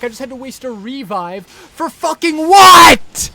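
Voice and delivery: loud, high yell of outrage; furious shout. Real, not acted. Gamer raging about wasting his revive, a furious shout. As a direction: bellow the complaint.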